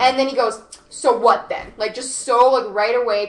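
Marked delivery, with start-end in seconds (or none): 0.9-1.7 imitated confrontational retort, loud; loud, combative comeback, retold. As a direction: throw it out as a challenge. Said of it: "confrontational and whatever".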